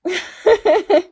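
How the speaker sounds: short, high, loud burst of laughter; real laugh at yourself. Real, not acted. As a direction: laugh at your own comeback. Laughs at own reply.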